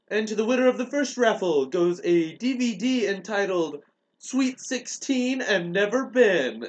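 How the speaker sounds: high, slow, drawn-out announcement; mock raffle announcer. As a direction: stretch the title like a game host. Party host announces raffle prize.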